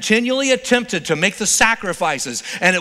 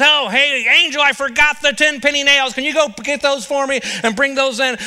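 higher, louder call to a helper; God as handyman; 6 semitones higher, 4 dB louder than usual. Pretend. God calling an angel in the shed, higher and louder. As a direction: call out casually across the workshop, pitched up. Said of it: "He goes".

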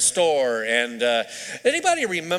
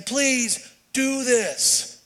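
very slow, high, earnest plea; begging; 7 semitones higher, much slower than usual. Retold or imitated. Paul urging the brethren, begging earnestly. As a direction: draw out each word as you beg. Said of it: "even to the point of begging".

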